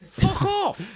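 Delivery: high, sharp fuck off; Roy Keane telling him off. Retold or imitated. Roy Keane snapping high at a nagging fan. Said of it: "Roy Keane".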